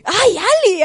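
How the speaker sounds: imitating grandma's high-pitched shocked outburst; mock shocked. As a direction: squeal it like a flustered grandma. Retold grandma's shocked exclamation.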